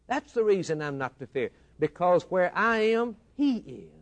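rhythmic, rising sermon cadence; preaching. Acted. Preacher to congregation, building sermon cadence.

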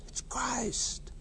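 soft reverent whisper. Acted. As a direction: whisper it as a holy secret. Preacher whispers the key line.

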